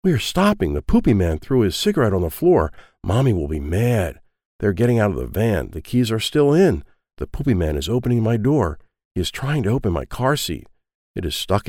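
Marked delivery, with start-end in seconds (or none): none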